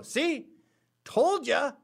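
high, mock-triumphant voice; imitation I-told-you-so, pitched high; vindicated protesters. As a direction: crow it like a protester proved right. Imagined protesters' triumphant accusation.

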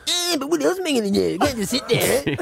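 mock Chinese accent, fast; imitated Chinese accent. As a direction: rattle it off in a put-on Chinese accent. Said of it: "She was all like".

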